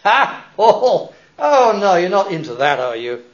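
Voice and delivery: loud, high scoffing laugh; mock the preacher openly. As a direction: scoff at him like a mocker. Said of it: "some mocked".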